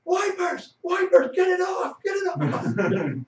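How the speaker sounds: very high, loud frantic yell; Rocket. Retold or imitated. Yelling as the crew hits Thor on the window. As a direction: shriek the order in mock panic. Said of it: "you hear Rocket yell".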